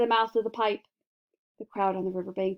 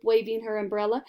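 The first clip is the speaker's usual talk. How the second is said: plain reading voice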